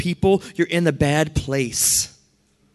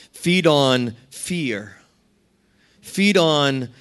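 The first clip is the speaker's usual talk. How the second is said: slow preaching; much slower than usual. Acted. Sermon list.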